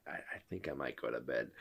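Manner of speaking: low, hushed, hesitant and quick; quick, soft mimicry, backing out; imitating wife bowing out. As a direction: mumble a sheepish retold excuse. Retold wife bailing on movie.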